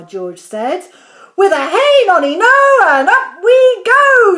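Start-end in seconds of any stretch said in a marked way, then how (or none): 1.3-4.4 high, loud crone's cry of glee; old woman shouting. A narrator's reading. Grandma shouting with joy as she grows upward. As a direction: belt it out like a giddy old grandma. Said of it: "she shouted".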